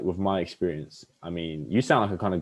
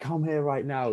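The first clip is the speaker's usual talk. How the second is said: higher, bossy command; mock command; 7 semitones higher, slower than usual. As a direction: order her over, half joking. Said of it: "I'll just be like".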